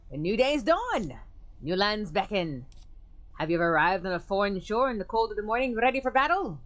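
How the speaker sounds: put-on orate like an old soldier; pompous military bluster; grandiose major.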